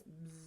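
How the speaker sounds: soft, low bzz; bee buzz. Shown as a demonstration. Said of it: "I hear bees".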